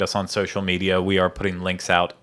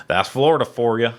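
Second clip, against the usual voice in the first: mock Southern accent; 3 semitones higher than usual. Recalls Florida accent bit.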